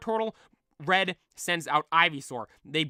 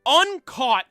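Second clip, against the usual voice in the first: dramatic build; 3 semitones higher, 8 dB louder than usual. Acted. Narrating the legend of Mew, dramatic build. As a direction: sell the mystery, building up.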